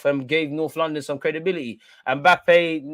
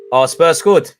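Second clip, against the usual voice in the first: loud, dismayed cry at the goal; loud gutted shout at the score; shocked outcry; 3 semitones higher, 11 dB louder, slower than usual. Real, not acted. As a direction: blurt it out loud in disbelief. Reacts to rival goal.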